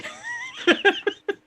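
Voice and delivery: high-pitched burst of laughter; laughing. Real, not acted. Amused by crystal sale memory.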